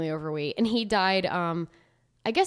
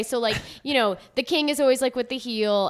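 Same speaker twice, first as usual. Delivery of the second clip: ordinary delivery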